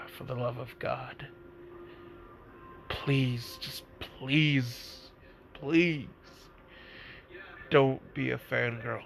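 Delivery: soft, tired begging; weary plea. Retold or imitated. Kakashi, with Fu snuggled on his chest, softly begs in weary dread. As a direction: plead quietly, worn out. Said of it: "and he's just like".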